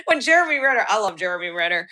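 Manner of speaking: usual speaking voice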